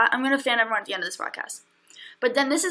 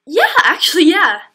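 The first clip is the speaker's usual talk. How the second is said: emphatic yeah; 5 semitones higher, 10 dB louder than usual. Retold or imitated. Eager yes to Puma guy.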